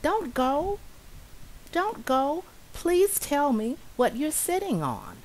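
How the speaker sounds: high, pleading little mouse. Acted. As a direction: beg the bee to stay, high. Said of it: "Little Mouse said".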